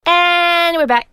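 slow, high, loud show return; radio host announcement. Acted. Back-from-break announcement.

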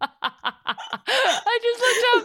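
high, wheezing words through laughter; laughing too hard to get it out; helpless laughter. Real, not acted. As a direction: try to speak while cracking up. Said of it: "I can't even say it".

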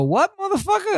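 high, sharp challenge to the weightlifter; retold challenge. Retelling how he faced down the weightlifter, a high indignant challenge.

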